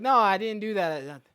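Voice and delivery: loud, slow, high pleading denial; retold shouted protest of innocence. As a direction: defend yourself loudly and slowly. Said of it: "I'm like".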